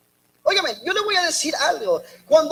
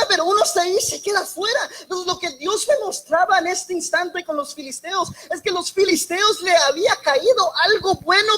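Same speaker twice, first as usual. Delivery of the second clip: ordinary delivery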